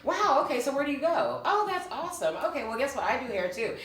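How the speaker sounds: high, loud, gushing salesgirl enthusiasm; excited chatter. Retold or imitated. Acting out her hair chat with a Verizon customer, high excited gush. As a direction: replay the giddy pitch to a customer. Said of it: "I'm like".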